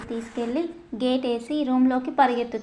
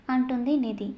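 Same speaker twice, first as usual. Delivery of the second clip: plain reading voice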